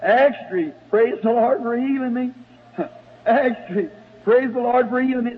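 high newsboy cry, half laughing; street hollering. Retold or imitated. Paper seller hollering praise on the street, high cry. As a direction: call it out like selling papers.

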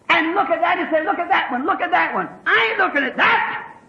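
heated, high pulpit exhortation; insistent revival preaching at a high pitch; full-force preaching. Real, not acted.

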